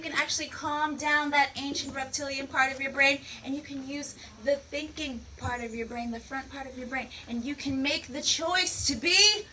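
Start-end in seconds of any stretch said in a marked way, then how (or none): none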